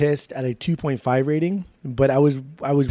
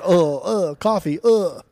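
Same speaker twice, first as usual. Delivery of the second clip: raised, hammed-up British with little grunts; mock Brit grunting round each word; silly grunty British accent; grunting British impression; 4 semitones higher, slower than usual. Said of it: "They just go walk around".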